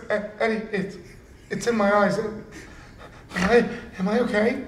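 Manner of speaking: stammering impression of a panicky rookie; imitating worried partner. Showing how his bleeding partner asked Eddie, mock-panicked. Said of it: "like this, like this".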